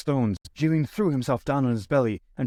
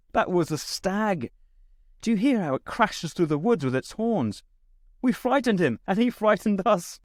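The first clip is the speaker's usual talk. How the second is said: high-pitched, amused reassurance; high and lively, just after laughing; 8 semitones higher than usual. A narrator's reading. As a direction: explain it lightly in a high, lifted voice. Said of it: "laughed and said".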